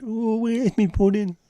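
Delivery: put-on squeaky old grandad wailing for his pudding; grandad next door. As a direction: whine it like a grumpy old grandad. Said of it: "he does the, uh, the grandad next door".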